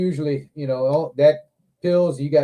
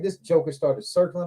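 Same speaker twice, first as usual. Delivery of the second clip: ordinary talk, nothing marked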